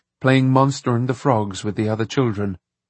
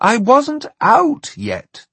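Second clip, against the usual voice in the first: exasperated; 13 semitones higher, 5 dB louder, slower than usual. A narrator's reading. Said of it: "in exasperation".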